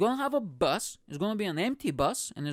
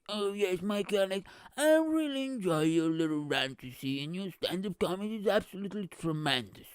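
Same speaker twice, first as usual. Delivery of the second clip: Richard Branson; 3 semitones higher than usual. Acted. Branson praising the comedian's rant, hammy British accent. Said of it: "so that's how he sounds".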